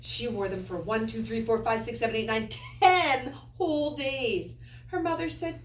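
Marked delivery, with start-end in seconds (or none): none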